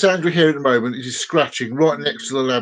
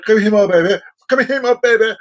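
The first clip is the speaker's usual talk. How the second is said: calling the cat; 6 semitones higher, 4 dB louder than usual. Real, not acted.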